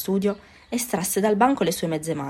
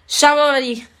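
a high, full-lung yell of a name; bellowed name; 8 semitones higher, 9 dB louder, slower than usual. A narrator's reading. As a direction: shout it like calling someone far away. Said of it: "lanciò a pieni polmoni, come se chiamasse qualcuno". (il nuovo grida il suo nome a pieni polmoni)

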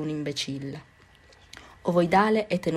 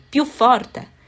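higher, much louder shout of a fed-up teacher; teacher's shout; 6 semitones higher, 8 dB louder than usual. A narrator's reading. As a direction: bark it across the classroom. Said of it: "gridò l'insegnante". (l'insegnante grida più forte al nuovo alunno)

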